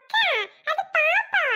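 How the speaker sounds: put-on young boy asking his big sister; little boy. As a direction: play the little brother, curious. Little brother explains belly.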